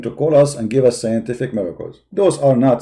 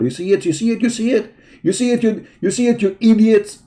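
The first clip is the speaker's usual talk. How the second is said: taunting; 6 semitones higher than usual. Real, not acted. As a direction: rub their nose in it. Taunting audience, rising pitch.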